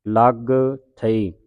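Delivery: loud ਲੱਗ with a doubled g, then separate ਥਈ; ਲੱਗ held on a hard double g; tightened consonant. Shown as a demonstration. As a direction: punch ਲੱਗ tight, pause before ਥਈ. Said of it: "ਕੱਸ ਕੇ ਬੋਲਣਾ ਹੈ".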